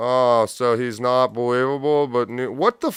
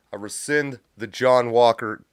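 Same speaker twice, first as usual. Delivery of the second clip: ordinary talk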